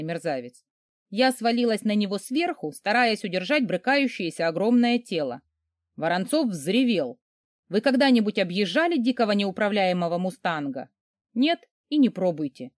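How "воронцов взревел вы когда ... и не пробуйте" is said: ordinary delivery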